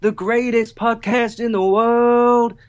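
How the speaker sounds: high, slow rock wail; drawn-out rock vocal on world; rock singer impression. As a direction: belt the repeat like a rock anthem. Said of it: "my rock impression".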